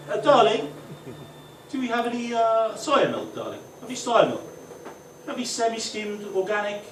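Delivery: high-pitched, lilting domestic request; genteel home voice. Acted. Man at home asking his darling for coffee, affectedly genteel. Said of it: "Fucking two different people".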